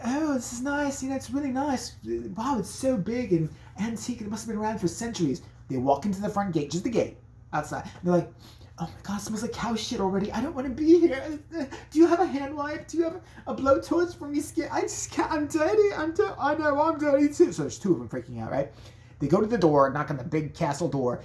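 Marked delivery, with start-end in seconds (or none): 0.0-5.4 high, airy impressed voice; high-voiced visitor admiring the castle. Voicing the impressed couple. 8.8-17.5 high, laughing squeamish voice; high OCD visitor panicking at the smell; panicking germaphobe. Retold or imitated. Couple freaking out.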